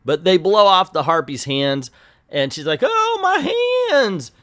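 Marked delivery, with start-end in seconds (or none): 2.8-4.3 high, loud, hammed-up cry of pain. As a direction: yelp as if your hands were just blown off. Harpy's hands blown off.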